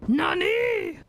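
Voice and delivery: surprised yell. Real, not acted. Surprised yelling.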